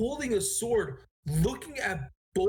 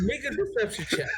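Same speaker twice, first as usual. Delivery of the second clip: higher, laughing through the words; 7 semitones higher than usual. Real, not acted. Game master reacting to player's dubious impersonation, laughing. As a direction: call for it through real laughter. Repeats the call while laughing.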